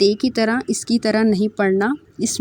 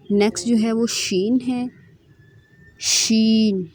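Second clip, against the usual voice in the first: normal talking voice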